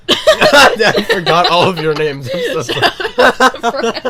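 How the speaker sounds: slow, high, laughing. Real, not acted. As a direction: laugh through the apology. Laughing through shout out.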